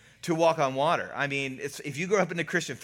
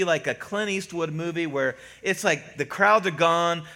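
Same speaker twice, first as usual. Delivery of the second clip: normal talking voice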